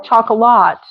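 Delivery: "Chocolate" ending in a full LAHT; wrong full last vowel. Shown as a demonstration. Teacher shows what not to say, chocolate with full vowel. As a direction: stress out the last syllable, chock-a-LOT. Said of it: "This is not O".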